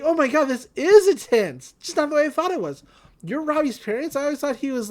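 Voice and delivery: retelling a cartoon scene as an excited girl. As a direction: gush like an eager kid surprised by the parents.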